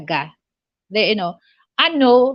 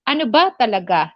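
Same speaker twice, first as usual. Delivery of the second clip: quick "Ano ba talaga" with BA stressed, at usual pitch; neutral asking accent; faster than usual. Shown as a demonstration. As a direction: simply ask "Ano ba talaga", leaning on ba. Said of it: "that's the accent".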